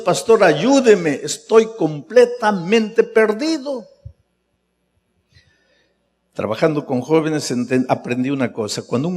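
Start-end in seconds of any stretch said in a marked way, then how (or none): 0.0-3.9 slow, high anguished plea, louder; young man crying to his pastor for help; pleading cry. Retold or imitated. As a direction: beg for help as if in tears. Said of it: "llorando y diciendo".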